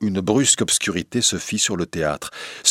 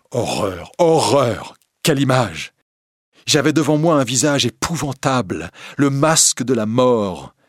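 a touch of acted horror, rising louder; horrified; 5 semitones higher, 4 dB louder than usual. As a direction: recoil from a dreadful sight, voice lifting. Christine recoils at unmasked face. (horreur jouée, qui monte)

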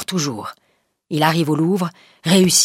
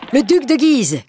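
a higher, louder announcing cry; shouting; 8 semitones higher, 4 dB louder than usual. Acted. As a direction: call out the arrival. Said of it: "crie Maurevel".

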